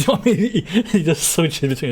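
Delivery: high, laughing through the words. Real, not acted. Streamer amused by the character's switching, laughing. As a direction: talk through a real laugh.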